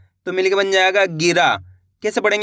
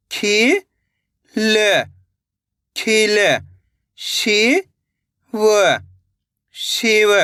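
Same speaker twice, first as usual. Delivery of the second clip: slow separate syllables, then the whole word; syllable blending drill; much slower than usual. Shown as a demonstration. Teacher sounding out syllables for children, slow and separate. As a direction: sound out each syllable for young pupils, then join them.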